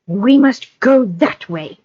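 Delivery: high, slow creature voice; Gollum voice. Acted. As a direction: lead them on like Gollum. Gollum leading them.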